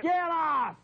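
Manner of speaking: high, sharp dismissal; angry yell. Real, not acted. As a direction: yell to cut the caller off. Host cutting off obscene caller.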